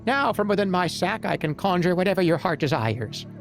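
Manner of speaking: high, odd goblin voice. Acted. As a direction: play a scheming little goblin. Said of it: "goblin speaks, voiced".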